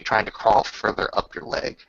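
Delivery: normal talking voice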